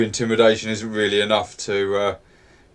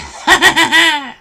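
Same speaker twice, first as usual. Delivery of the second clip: very high, loud goblin cackle; goblin laugh; 9 dB louder than usual. Acted. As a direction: cackle shrilly like a gloating goblin. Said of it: "this goblin just laughs".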